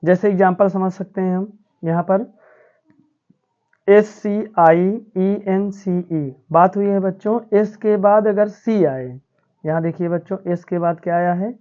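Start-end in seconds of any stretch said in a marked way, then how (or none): 3.9-6.4 put-on drawn-out letter-by-letter spelling for children; slow spelling out. Shown as a demonstration. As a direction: spell each letter very slowly, one by one. Teacher spells word letter by letter. (बच्चों के लिए धीरे-धीरे अक्षर बोलना)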